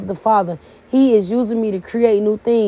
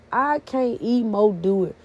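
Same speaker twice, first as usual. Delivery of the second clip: ordinary talk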